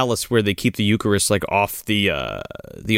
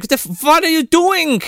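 much higher, shrill alarm; shocked German guy; 4 dB louder than usual. Retold or imitated. German guy walks in on the ritual, shrill alarm. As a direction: yelp it like a caught villain. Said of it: "he's like".

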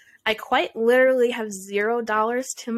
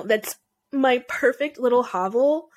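ordinary talk, nothing marked

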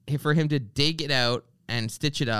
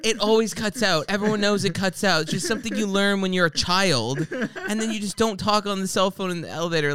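mock angry rant; 6 semitones higher than usual. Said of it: "I'm so angry".

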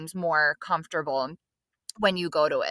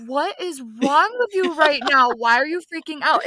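a bit higher and louder, a playful imitation of disbelief; lightly acting out a friend's bafflement; mock shocked friend; 6 semitones higher, 4 dB louder than usual. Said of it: "you were like".

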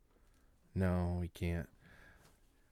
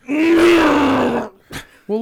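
loud; 12 dB louder than usual. Acted. Mock outrage drawn out.

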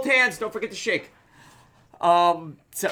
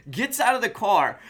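ordinary delivery

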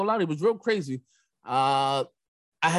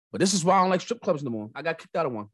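ordinary talk

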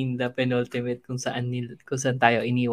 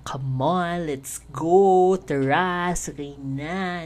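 hype call; 4 semitones higher, 5 dB louder, much slower than usual. Real, not acted. Host hyping up the sail-off.

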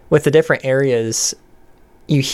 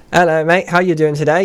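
put-on higher-pitched, tidier British accent; 5 semitones higher than usual. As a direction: greet a mate in a British accent, voice raised in pitch. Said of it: "So instead of saying".

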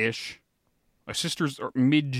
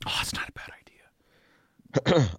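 slow whispered mock scheme; conspiratorial whisper; 4 semitones higher, slower than usual. Real, not acted. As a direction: whisper it like you're plotting. Jokingly considers stealing ID.

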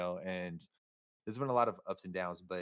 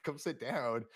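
casual slow invite; 3 semitones higher, much slower than usual. Retold or imitated. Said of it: "They were just like".

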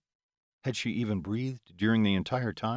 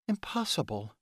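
disbelieving female voice; 4 semitones higher, 3 dB quieter than usual. Acted. Heroine refuses to believe.

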